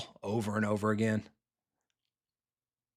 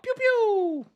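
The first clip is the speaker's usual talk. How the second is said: high, loud pew pew; mock gun noises; 4 dB louder than usual. Streamer miming shots after a throw, high pew noises. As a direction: fire off toy laser sounds. Imitating gunshots.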